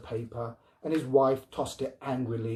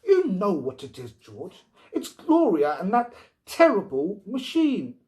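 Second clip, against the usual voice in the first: higher, louder exasperated complaint; exasperated wife; 7 semitones higher, 5 dB louder than usual. Acted. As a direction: press him, fed up. Wife complains about robot.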